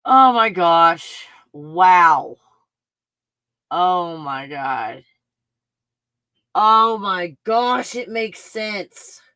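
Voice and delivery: slow, drawn-out loud amazement; awestruck. Real, not acted. Vlogger staring at the pictures, slow and awed. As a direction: stretch each word in awe.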